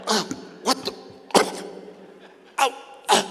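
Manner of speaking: strangled grunts as if throat is squeezed; acted choking. Son caught in dad's hold, mock choking. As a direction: sputter as if being choked. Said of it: "something pushing up on my jugular".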